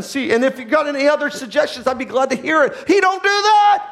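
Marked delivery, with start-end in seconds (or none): 2.9-3.9 high, loud, slow and drawn out; preaching shout. Acted. Preacher to congregation, emphatic shout. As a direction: hammer it home to the congregation. Preacher declaring God won't.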